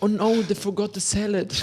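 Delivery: high-pitched mock lament; meme customer's dismay. Meme customer finds salad missing from the order, high mock lament. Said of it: "Sie bestellt, äh, ein Menü".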